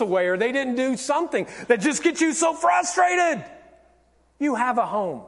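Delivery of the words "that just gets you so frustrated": mock frustration. Acts out frustration at household.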